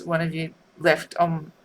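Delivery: normal talking voice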